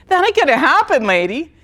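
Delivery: flat-out refuse her, half laughing; defiant refusal. Retold or imitated. Retells refusing the counselor.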